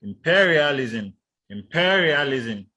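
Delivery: Imperialism with PE loud, long and high; stress on second syllable. Shown as a demonstration. Teacher showing second-syllable stress, Imperialism stressed loudly on PE. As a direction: punch PE each time in Imperialism. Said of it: "stress is on the second syllable".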